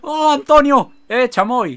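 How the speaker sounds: very high, loud laughing shrieks; overjoyed yells at the surprise party; excited laughing shout. Real, not acted. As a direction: squeal with delight at the surprise. Villagers surprise him with party.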